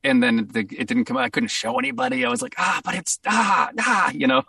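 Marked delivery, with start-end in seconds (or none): 2.6-4.1 high, strained cry of frustration; frustrated groan. Retold or imitated. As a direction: groan out the letdown. Frustrated at unreleased game.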